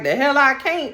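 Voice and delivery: sassy pushback at Colin; defiant retort. Retold or imitated. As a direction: snap back in defiance. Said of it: "Penelope is like".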